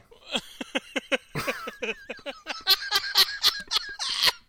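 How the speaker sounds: high-pitched helpless cackling; long laughing fit. Real, not acted. Long laughing fit after the question.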